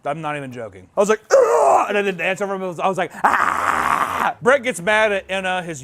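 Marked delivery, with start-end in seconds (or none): 1.3-1.9 high, loud mock "ugh"; hammed-up shouted groan; acted yell. Acts out his own reaction. 3.2-4.4 loud, raw mock scream; hammed-up yell; acted scream. Acts out his own reaction.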